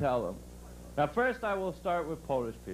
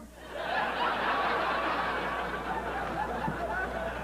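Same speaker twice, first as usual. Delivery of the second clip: long airy laugh that climbs high. Real, not acted. Comic cracking up at his own fire truck joke.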